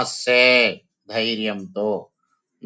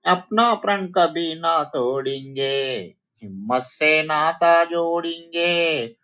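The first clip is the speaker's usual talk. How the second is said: raised, sing-song verse; poem recitation for children; 6 semitones higher than usual. Shown as a demonstration. Teacher reciting the poem to the children, sing-song. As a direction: chant it to the children.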